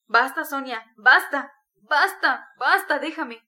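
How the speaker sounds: loud, anguished plea to stop; heart-rending outcry. A narrator's reading. Raskolnikov begging Sonia to leave him, heart-rending. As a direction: cry out in torment, loud. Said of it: "exclamó de pronto con acento desgarrador".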